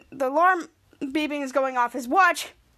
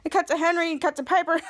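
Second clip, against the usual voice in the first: usual speaking voice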